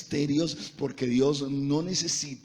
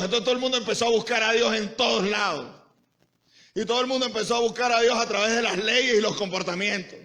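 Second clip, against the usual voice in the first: driving, raised-pitch sermon delivery; full-force preaching; 8 semitones higher, 5 dB louder than usual. Acted. Preacher telling the congregation how people went searching for God, full-force preaching. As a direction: preach it hard, in an unbroken rush of phrases.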